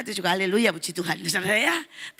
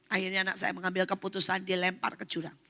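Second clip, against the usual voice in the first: ordinary talk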